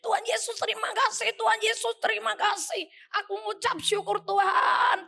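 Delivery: high, repeated cries of thanks; pleading high-pitched prayer, acted out; retold thanksgiving prayer. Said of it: "Saya cuman ngucap syukur sambil itu".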